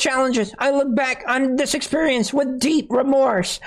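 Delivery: high, whiny fake-tearful whimper; mock crying voice. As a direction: ham up a weepy confession. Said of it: "her voice breaking at times".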